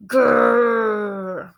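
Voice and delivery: loud growl, trying to be fierce; dog growl. Acted. Dog growling at the burglar, loud mock-fierce growl. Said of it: "she growled".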